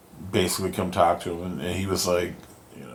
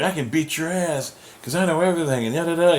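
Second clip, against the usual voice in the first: put-on cocky girl voice; 9 semitones higher than usual. Gwenpool taunting Deadpool.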